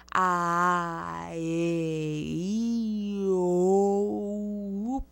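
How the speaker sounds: A, E, I, O, U each mouthed wide and drawn out; exaggerated vowels. Shown as a demonstration. Coach demonstrating exaggerated vocalization of the vowels. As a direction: stretch every vowel with big jaw and lips. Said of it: "con unos movimientos muy exagerados".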